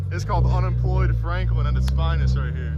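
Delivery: ordinary talk, nothing marked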